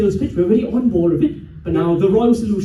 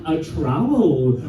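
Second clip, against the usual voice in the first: lower, slow, drawn-out announcement; grand redo; 8 semitones lower, much slower than usual. Acted. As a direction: present the trowel as a wondrous thing. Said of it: "let me redo that".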